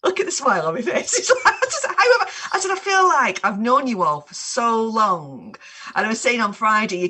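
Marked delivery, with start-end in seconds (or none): 0.0-5.6 delighted. Real, not acted. Delighted greeting of an old friend.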